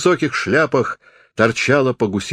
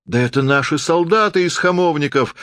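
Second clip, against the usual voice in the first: high, rapid-recognition call from the audience; mild shout; 7 semitones higher than usual. A narrator's reading. Someone in the audience recognizes the soldiers, calling out. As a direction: call it out with raised pitch. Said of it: "крикнул кто-то за мною".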